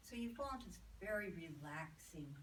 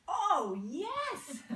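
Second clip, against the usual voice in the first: delighted exclamation; 8 semitones higher, 16 dB louder than usual. Retold or imitated. Mother's delighted answer.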